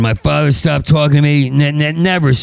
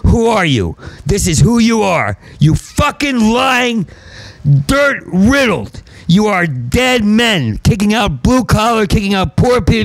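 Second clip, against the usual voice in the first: angry rant; 8 semitones higher than usual. Real, not acted. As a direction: rail at them from high up. Escalating rant at audience.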